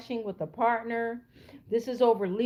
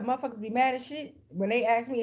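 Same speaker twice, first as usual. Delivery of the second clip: normal talking voice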